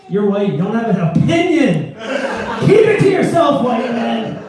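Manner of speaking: hammed-up scolding, rising to a high shout; mock-indignant outburst, loud and high; mock outrage. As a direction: playfully shout down a heckler. Mock telling off an audience member.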